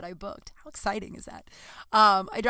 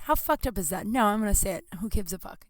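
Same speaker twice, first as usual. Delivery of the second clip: normal talking voice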